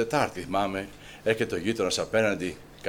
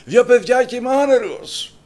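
high, loud desperate plea; loud pleading cry; 11 dB louder than usual. Acted. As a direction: shout your need to God. Shouted plea to God.

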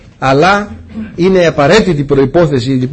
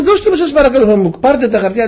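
mock pleading; 8 semitones higher than usual. Pleading with policeman.

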